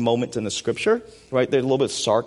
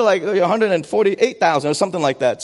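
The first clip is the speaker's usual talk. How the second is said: normal talking voice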